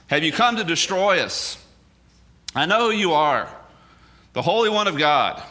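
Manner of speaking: understated cry of recognition; mild demon outcry. Acted. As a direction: lightly act a possessed man's challenge. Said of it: "he cried out in a loud voice".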